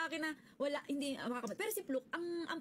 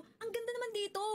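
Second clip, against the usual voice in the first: a higher, delighted "ang ganda naman dito"; amazed gush; 8 semitones higher than usual. Retold or imitated. Telling friends how she gushed at the venue, higher and delighted. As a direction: gasp at how pretty the place is. Retelling her own amazed reaction.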